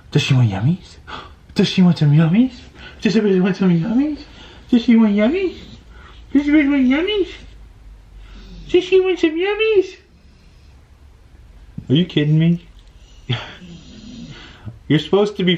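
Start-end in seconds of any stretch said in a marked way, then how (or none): 0.1-10.0 teasing dog voice climbing higher each time. Real, not acted. Coaxing the dog with a treat, rising excitement.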